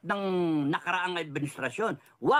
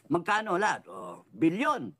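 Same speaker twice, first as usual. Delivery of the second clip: ordinary talk, nothing marked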